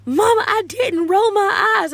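high, loud, drawn-out protest; mock child whining. Daughter denying to mama, loud hammed-up whine. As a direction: wail your innocence like an indignant kid. Daughter's louder denial, acted.

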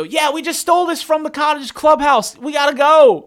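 high, loud, hurried; urgent excited guys. Retold or imitated. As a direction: rush it like you're fleeing. Thieves urgently asking for ride.

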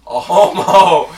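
slow, loud mocking voice; put-on voice. Friend teasing Caleb, slow loud put-on voice. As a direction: drag it out as a jab.

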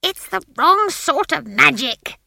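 high, loud, slow screech. A narrator's reading. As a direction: screech it out in alarm. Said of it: "screeched Granny".

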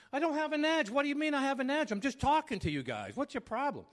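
high, loud, defensive outburst; edgy. Retold or imitated. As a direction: re-enact an indignant argument at full pitch. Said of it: "No, you have an edge".

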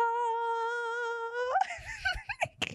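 high squealing giggle; can't stop giggling; breaking character giggling. Real, not acted. Giggling fit after joke.

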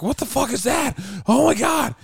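high, loud panicked cry. Retold or imitated. Frightened campers hearing the creature, high-pitched panic. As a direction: mimic terror at a sudden scream. Terrified campers hear the scream.

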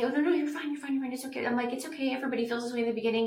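mock soothing of a nervous model; imitating coaxing photographers.

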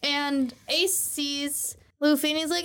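ordinary delivery

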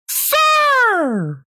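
very high, shrill yell of "Sir"; mock yelling. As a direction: imitate a woman yelling from outside the car. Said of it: "yelling at me".

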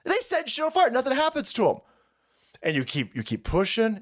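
ordinary delivery

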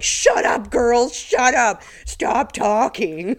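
half-joking yell to shut them up; mock scream at girls. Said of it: "start screaming at them like".

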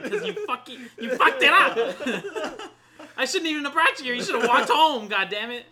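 high, loud, laughing mock fury; retelling yelling at a friend in the truck, laughing; mock angry rant. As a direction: scold the friend in hammed-up rage.

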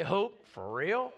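slow, doubtful "Hope for real?" with a rising end; eye-rolling teen scoffing; preacher mocking a teenager's sarcasm; sarcastic teenager. Shown as a demonstration. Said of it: "with a sarcastic twist".

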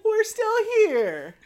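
high, drawn-out melting coo; touched, gushing. Acted. Fan sees the series' old trademark, touched cooing. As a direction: let the heart melt, hammed up. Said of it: "my heart just went like".

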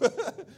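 high, bright burst of laughter; a short laugh at yourself. Real, not acted. Pastor laughing with the church, short high laugh.

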